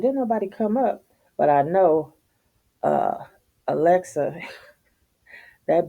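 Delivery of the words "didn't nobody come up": high puzzled question. Retold or imitated. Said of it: "And I said".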